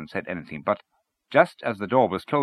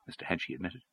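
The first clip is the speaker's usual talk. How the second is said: ordinary delivery, nothing marked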